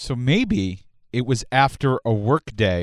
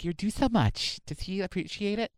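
put-on soft, raised girlfriend voice; mock sympathy; 5 semitones higher, 9 dB quieter than usual. Friend pressuring the wife about appreciation, soft mock sympathy. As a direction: coo it softly and a little high.

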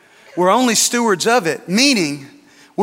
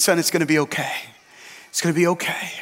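comforting; 5 dB quieter, faster than usual. Retold or imitated. Executive comforts weeping boy.

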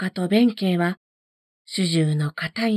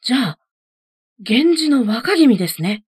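a little louder, amazed recognition; surprised realisation; 3 semitones higher, 5 dB louder than usual. A narrator's reading. Monk realises he faces the young Genji lord, mildly amazed. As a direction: suddenly realise who stands before you. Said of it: "弁慶は驚いて".

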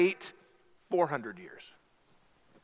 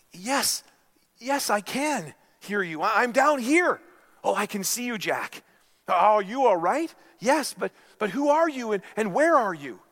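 frightened Jack; 6 semitones higher, 4 dB louder than usual. Acted. Jack answers from the cliff.

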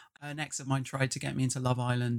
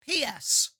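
lightly plummy, higher, slow grand lady; Dowager Duchess voice; 7 semitones higher, slower than usual. Acted. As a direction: read it like an imperious dowager. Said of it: "I can't turn the Dowager Duchess voice off now".

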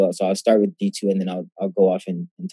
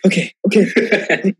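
high, slow, teary okay; choking back tears while agreeing; tearful okay; 9 semitones higher, slower than usual. Retold or imitated. Runner answering her coach through tears, high and slow.

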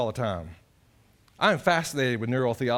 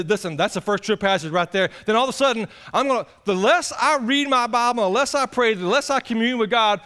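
loud, raised preacher's cry; full-force preaching; 7 semitones higher, 5 dB louder than usual. Acted. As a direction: drive it home to the congregation.

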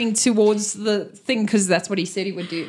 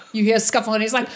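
ordinary delivery

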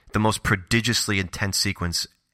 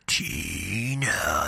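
gravelly, drawn-out eerie call; Freddy Krueger; 2 semitones lower, 3 dB quieter than usual. Acted. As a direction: stretch the name out like a sinister caller in the dark. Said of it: "punctured by this gravelly sound of someone".

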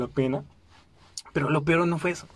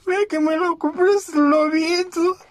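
very slow, much higher, louder sobbing plea; blubbering apology; 13 semitones higher, 4 dB louder, much slower than usual. Retold or imitated. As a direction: sob out the apology, barely getting words through. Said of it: "Y empecé a llorar y a llorar".

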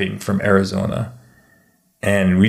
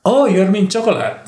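put-on high, loud Filipino-accented "chocolate" as shaw-koh-lat; 11 semitones higher, 4 dB louder than usual. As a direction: mimic a Filipino asking back, pitched up. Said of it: "do a Filipino accent".

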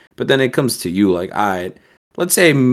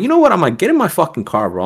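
high-pitched burst of mock outrage; retold anger; 10 semitones higher, faster than usual. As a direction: re-enact losing your temper, pitch leaping up. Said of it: "And I got so mad".

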